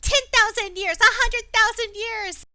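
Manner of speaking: high, loud little creature voice; pixie. Acted. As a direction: squeak it out like a tiny sea creature. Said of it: "a little voice in your head".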